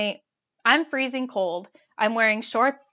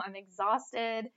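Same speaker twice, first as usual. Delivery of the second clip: ordinary delivery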